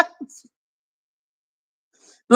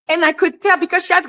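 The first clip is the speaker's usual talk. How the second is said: usual speaking voice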